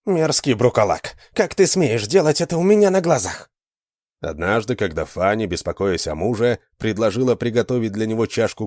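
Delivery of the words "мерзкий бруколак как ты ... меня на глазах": high, harsh scolding; snatch the child and scold; furious scolding. Acted. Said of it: "приговаривая".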